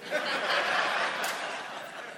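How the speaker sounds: breathless unvoiced chuckle; a real laugh at his own joke; airy laugh. Real, not acted. Pastor laughing after teasing the timekeeper, airy laugh.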